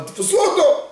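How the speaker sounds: angry order. Retold or imitated. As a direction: bark it out like an angered judge. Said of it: "הקאדי התעצבן".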